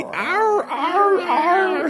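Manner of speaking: very high, drawn-out whimpers; whining puppy. Acted. Recreating the recording test for friends, high puppy whine. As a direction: whine it like a little pup. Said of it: "you whined like a little puppy".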